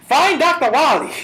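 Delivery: loud, high blurted shout; mock outburst. Host derailing his own fumbled sentence, high laughing shout. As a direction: yell it suddenly, breaking into laughter.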